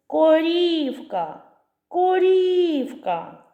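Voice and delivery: «Корівка» called high and slow, long stressed і; calling out. Shown as a demonstration. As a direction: call the cow, ko-REEE-vka in «Корівка». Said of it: "Покличемо корівку". (кличе протяжно, наголос на «і» в слові «Корівка»)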